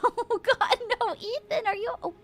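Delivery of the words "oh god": normal talking voice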